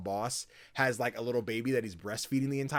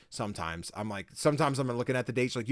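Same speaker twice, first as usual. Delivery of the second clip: usual speaking voice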